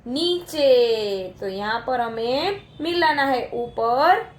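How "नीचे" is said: drawn-out answer. Shown as a demonstration. Teacher giving children the answer, slowly drawn out. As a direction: stretch the word out very slowly. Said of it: "ऊपर का उल्टा क्या होता है?".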